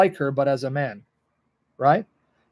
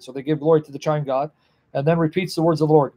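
usual speaking voice

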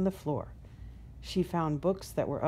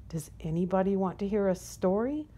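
ordinary delivery, nothing marked

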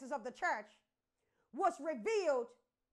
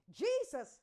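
ordinary delivery

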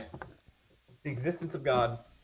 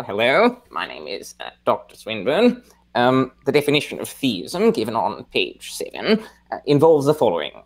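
put-on halting, donnish British accent with swooping pitch; Richard Swinburne impression. Co-host impersonates a philosopher for the audience, Swinburne impression in British accent. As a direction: imitate a British philosopher's lilting, stop-start delivery. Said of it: "your best Richard Swinburne impression".

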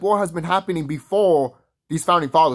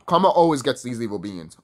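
ordinary talk, nothing marked

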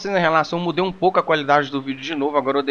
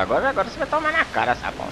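taunting; 8 semitones higher, faster than usual. Real, not acted. Taunting the boss.

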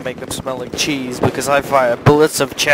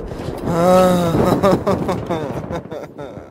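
long laugh that tumbles down in pitch; 5 semitones higher than usual. Real, not acted. Gamer laughing after another death, long falling laugh. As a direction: laugh it out, gradually running down.

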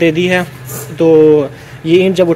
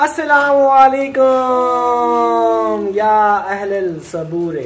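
booming channel greeting; 6 semitones higher, much slower than usual. Shown as a demonstration. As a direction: proclaim the opening like a herald, slowly. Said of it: "ایسے کہتے ہیں۔".

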